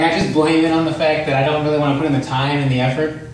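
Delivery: usual speaking voice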